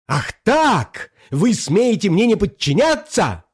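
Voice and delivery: high, indignant tyrant; affronted ruler losing his temper; outraged demand. A narrator's reading. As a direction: challenge them in high-pitched fury. Usurper outraged at being disobeyed.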